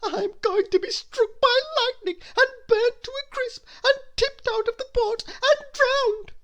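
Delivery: very high, loud crying wail; wailing panic. A narrator's reading. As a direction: sob out the doom in terror. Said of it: "he shrieked".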